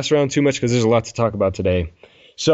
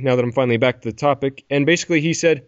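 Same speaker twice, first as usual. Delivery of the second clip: normal talking voice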